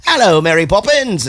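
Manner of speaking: a hint of a bad posh English accent; mock American-English accent. Americans doing English accents, lightly mock English. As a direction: put on a cheesy English accent. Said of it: "every American's English accent".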